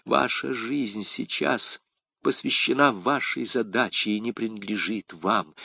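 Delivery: plain reading voice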